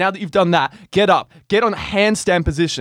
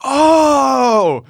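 loud, higher shocked gasp; 10 semitones higher, 7 dB louder than usual. Retold or imitated. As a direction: react as if wincing at a bad clash. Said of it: "I hear kind of like the whisper of".

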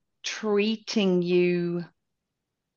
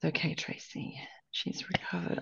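"she's recovered" whispered much softer, as a playful aside; stage whisper; 3 semitones lower, 14 dB quieter than usual. Shown as a demonstration. Host teasingly reassuring Tracy, in a soft stage whisper. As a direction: lean in and whisper it like a secret. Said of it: "for listeners".

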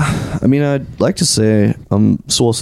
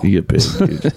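whispered, lower hype; mock-dramatic whisper; 4 semitones lower than usual. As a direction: join in with a hushed whisper.

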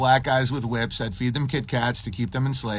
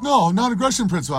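high, fast mock objection; mock protest; 8 semitones higher, 4 dB louder, faster than usual. Libertarian refusing the chains, mocked high protest.

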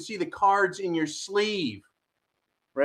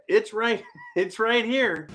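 ordinary delivery

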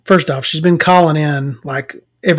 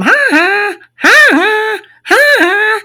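high cartoon "ha ha" on repeat; Simpsons character's taunt; 6 dB louder, slower than usual. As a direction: imitate the repeated taunting catchphrase. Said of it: "He keeps going".

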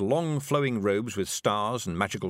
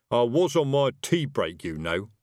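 put-upon grumble, louder then dropping away; reproachful; 5 dB louder than usual. Acted. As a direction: complain reproachfully about being disturbed. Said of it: "adding reproachfully".

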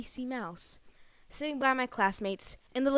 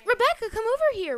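high, carrying call of "Rebecca"; calling teacher; 11 semitones higher than usual. Retold or imitated. Teacher calling the crying child over, high and carrying. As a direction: call across the classroom like a teacher. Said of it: "she's like".

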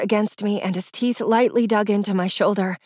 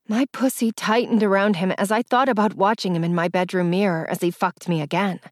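ordinary delivery, nothing marked

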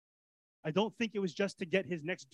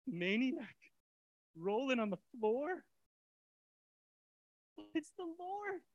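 ordinary talk, nothing marked

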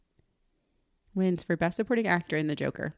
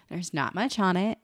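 ordinary talk

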